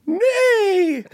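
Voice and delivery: high comic horse whinny; horse neigh. Acted. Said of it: "sounding like a horse".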